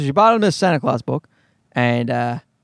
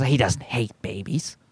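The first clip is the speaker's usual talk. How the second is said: lower, softer imitation of a wife; understated reproof, voiced low; correcting wife; 7 semitones lower, 6 dB quieter than usual. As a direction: quote a wife's mild correction, quietly. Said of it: "My wife says".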